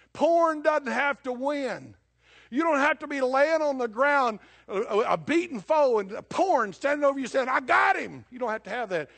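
loud, high, fervent pulpit shout; preaching at full force. Acted. Preacher urging Christians against sin, loud impassioned preaching. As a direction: drive it home like a revival preacher.

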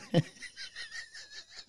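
high wheezing laugh; helpless high-pitched laughter; shrieking laugh. Real, not acted. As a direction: burst into a high breathless cackle. Laughing hard at the clip.

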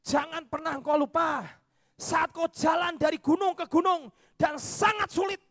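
loud, high, pleading preacher shout of the divine command; God's booming voice. Acted. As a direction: thunder it out as God reminding him. God's voice rises.

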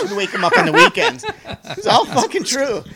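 high voice through laughter; laughing while talking. Real, not acted. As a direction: talk through the giggles.

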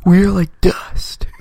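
slow, whispery dude voice; hushed, mock-profound bro; awed surfer dude. Quotes Bill and Ted line.

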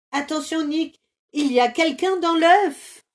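high, loud warning; alarmed warning. Acted. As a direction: warn your friend in a fright. Hérisson alarmé par l'œuf. (avertit Nick, alarmé, qu'il y a quelqu'un)

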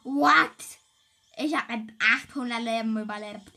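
loud astonished what; shocked shout. Real, not acted. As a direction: blurt it out in disbelief. Amazed at surviving. (lauter ungläubiger Ausruf)

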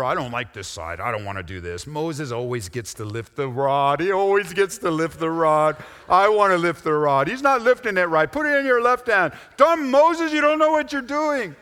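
put-on childish complaining voice, rising to a shrill peak; whiny squabbler. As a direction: play a petulant rival griping about Moses.

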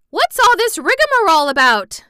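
put-on high, loud exasperated old aunt; impatient cry. Aunt Em loses patience with the rambling, high impatient cry. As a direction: throw up your hands and cry it out. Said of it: "cried Aunt Em impatiently".

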